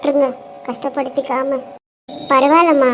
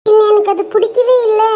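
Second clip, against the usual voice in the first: put-on higher, louder little kid; child complaining, pitched up; 7 semitones higher, 4 dB louder than usual. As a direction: whine it like a young child.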